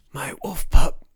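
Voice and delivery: loud, strained rasp; dying rasp. A narrator's reading. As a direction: force the words from a ruined throat. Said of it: "said a rasping voice".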